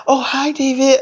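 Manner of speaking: a hint of a cheerful young woman; Madison greeting. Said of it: "and she's like".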